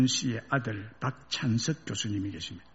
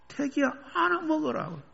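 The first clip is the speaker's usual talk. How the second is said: mother calling again; 12 semitones higher than usual. Retold or imitated. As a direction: repeat her words fondly. Mother line repeated.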